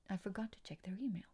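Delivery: hushed aside to herself; whispering. Real, not acted. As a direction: whisper it under your breath.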